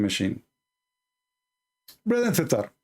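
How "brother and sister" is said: high, squeezed mocking preacher voice; mock lisping preacher, pitched up; Zakir Naik impression. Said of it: "I'm resembling, uh, Zakir Naik".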